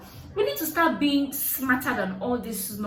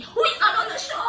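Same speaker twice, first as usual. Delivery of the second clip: high, loud mock outrage, half laughing; mock outraged yell; 13 semitones higher, 5 dB louder, faster than usual. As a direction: yell who is an Olosho. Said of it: "I started shouting".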